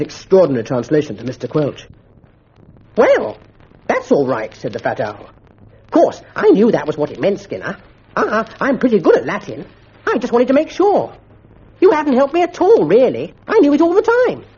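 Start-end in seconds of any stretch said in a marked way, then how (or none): 3.0-4.5 high squeaky schoolboy; pitched-up fat owl; Bunter's voice. A narrator's reading. Bunter answers Skinner in a high smug voice. 5.8-14.4 high, squeaky and smug; Bunter boasting. A narrator's reading. Bunter brags to Skinner in a high smug voice.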